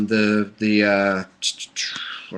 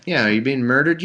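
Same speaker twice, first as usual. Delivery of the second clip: ordinary delivery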